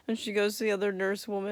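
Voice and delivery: usual speaking voice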